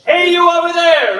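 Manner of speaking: big, high projected holler; loud, thick chest-voice shout; big call-out. Shown as a demonstration. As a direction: call across the room, nice and big. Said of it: "I know you got bigger voices than that".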